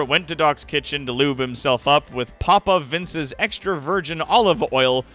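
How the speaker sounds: ordinary talk, nothing marked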